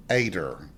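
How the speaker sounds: low, quiet Ader, AY-der. Shown as a demonstration. Repeating the great aunt's name, Southern Ader. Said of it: "She was actually called Ader".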